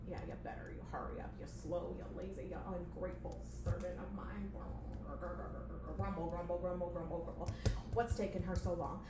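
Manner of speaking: low, very quiet angry muttering; grumpy boss muttering to himself; grumbling boss. Acted. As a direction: grumble under your breath about the assistant. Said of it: "playing the boss character".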